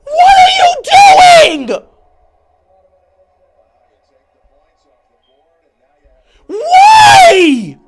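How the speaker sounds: long, stretched, very high scream; anguished screaming. Real, not acted. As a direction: scream it out at the players. Screams at the team.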